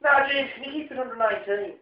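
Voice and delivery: a hint of his Irish dad telling him to turn the TV down; lightly imitating his father's thick Irish accent; mock Irish dad. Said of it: "your parents' accents really as strong".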